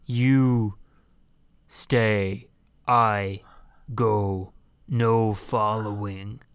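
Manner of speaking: low, slow, halting giant; word by word, low, with long gaps; giant robot voice. Retold or imitated. Quoting the giant's touching line.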